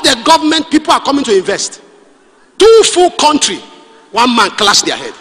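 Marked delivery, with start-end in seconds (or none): 2.6-3.6 incredulous exclamation. Real, not acted. Incredulous preacher.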